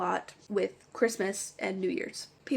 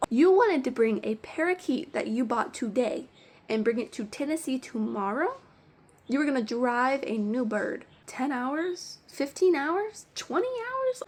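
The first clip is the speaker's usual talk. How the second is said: incredulous questioning; 3 semitones higher than usual. Retold or imitated. Store worker challenging a customer driving a new bird to Tennessee, incredulous. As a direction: question it in mounting disbelief.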